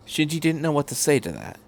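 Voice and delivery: usual speaking voice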